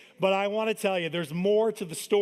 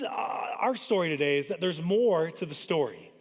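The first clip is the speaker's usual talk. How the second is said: ordinary delivery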